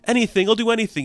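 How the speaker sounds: mocking mimicry. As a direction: parrot his begging back to him. She mocks his begging.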